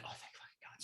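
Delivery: relieved whisper, almost voiceless; whispered relief. Retold or imitated. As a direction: breathe it out in a whispered thank-god. Relief at finding porta john.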